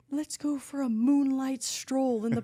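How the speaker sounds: hammed-up romantic invite, slow; mock boyfriend. Boyfriend at the park, mock-smooth slow invitation. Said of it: "And he's like".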